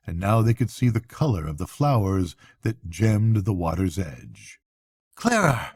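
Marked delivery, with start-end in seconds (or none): 5.2-5.7 much higher, joyous exclaim; thrilled as the music nears; joyous cry. Acted. Rat cries out joyously to Mole as the piping grows. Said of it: "cried the Rat joyously".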